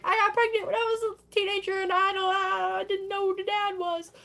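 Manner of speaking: high mock-wailing lament; hammed-up despairing whine; distraught confession. Donna distraught, confessing to her friends.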